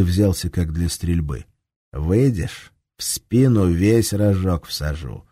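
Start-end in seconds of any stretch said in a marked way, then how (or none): none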